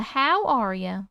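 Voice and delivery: slow drawled Southern "how are ya"; Southern accent. Pretend. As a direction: greet her with a lazy Southern drawl. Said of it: "you like the Southern accent?".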